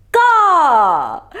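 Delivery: ordinary delivery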